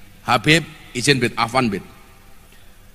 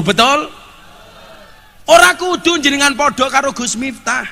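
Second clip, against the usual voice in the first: raised pitch, building to slow, emphatic words; rousing preaching; 8 semitones higher, 6 dB louder than usual. Real, not acted. Preacher urging the congregation to stay brothers, rousing preaching. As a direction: proclaim unity to a big crowd.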